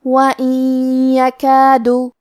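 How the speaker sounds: وَإِن with the noon merged into يَكَادُ, held in the nose; slow, nasalised idgham on وَإِن يَكَادُ; nasal merge demo. Shown as a demonstration. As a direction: sound وَإِن يَكَادُ as wayyakadu, slow, with ghunna. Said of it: "یہ حکم کو ادا کریں گے".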